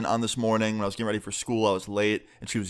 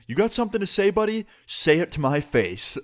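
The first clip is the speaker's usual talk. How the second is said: higher-pitched, acted aggressive challenge; mock confrontational challenge; 7 semitones higher than usual. Teacher standing over a student's desk, mock confrontational. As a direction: act out squaring up to someone, pitched up.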